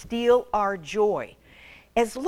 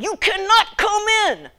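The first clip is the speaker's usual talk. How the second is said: high, loud sulky no; mock teen refusal, pitched up; bratty daughter; 13 semitones higher, 6 dB louder than usual. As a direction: snap it back like a fed-up teen.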